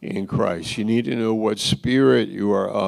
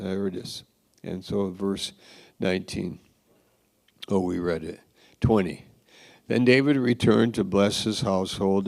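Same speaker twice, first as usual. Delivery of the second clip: ordinary talk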